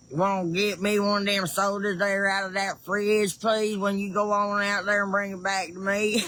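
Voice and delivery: high-pitched mock thick Southern accent; imitating thick Southern drawl. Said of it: "talk like that for them".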